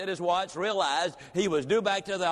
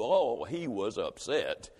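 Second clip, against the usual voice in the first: low dramatic refrain; 6 semitones lower, slower than usual. A narrator's reading. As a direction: drop low and slow on the refrain. Storyteller's repeated refrain.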